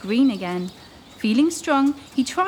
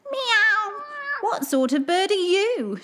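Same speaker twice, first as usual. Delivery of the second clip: much higher meowing cat voice; a prowling cat, curious; 9 semitones higher, slower than usual. Acted. Old cat asking the duckling what bird he is, curious. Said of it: "she asked".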